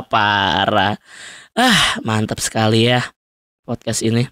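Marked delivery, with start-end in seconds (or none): none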